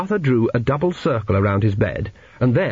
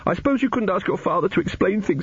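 put-on high, tentative child's plea; worried little boy; 8 semitones higher than usual. A narrator's reading. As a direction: ask timidly in a small boy's high voice. Narrator voices pleading Arthur.

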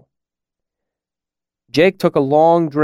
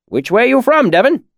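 put-on high, loud gruff old man; old villager voice; 12 semitones higher, 4 dB louder than usual. A narrator's reading. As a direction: demand it bluntly. Cobb questioning.

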